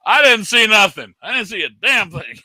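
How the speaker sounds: loud fake denial; feigned innocence. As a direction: insist you saw nothing. Pretending not to see.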